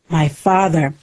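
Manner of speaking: usual speaking voice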